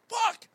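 high, sharp yelp of pain; high, pained swear; pained shout. Retold or imitated. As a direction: yell as the punch lands. Getting punched in the side.

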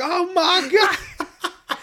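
high, drawn-out mock scream; hammed-up high-pitched scream. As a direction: wail it out in exaggerated horror. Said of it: "he's just fucking screaming like".

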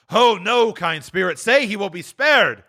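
begging the spirit; pleading Scrooge. A narrator's reading. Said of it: "said Scrooge".

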